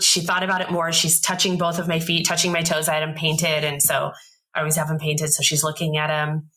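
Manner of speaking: usual speaking voice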